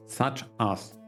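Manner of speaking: ordinary talk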